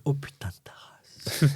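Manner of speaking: slow, voiceless aside; whispered swear. Acted. Guest imagining his reaction to the phrase, whispered. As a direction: curse under your breath, drawn out.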